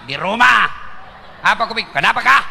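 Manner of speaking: loud, blunt greeting; mock stern woman on the phone; firm voice. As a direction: answer firmly, no softness. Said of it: "kasih tegas suaramu".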